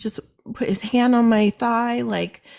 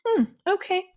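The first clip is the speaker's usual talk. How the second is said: suspicious hmm; 11 semitones higher than usual. Retold or imitated. Retold suspicious reply.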